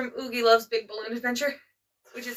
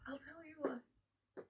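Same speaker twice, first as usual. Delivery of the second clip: whispering; 2 semitones higher, 25 dB quieter, much slower than usual. Real, not acted. Teasing whisper reply.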